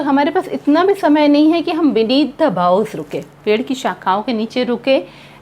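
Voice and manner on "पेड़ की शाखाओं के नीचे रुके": ordinary talk, nothing marked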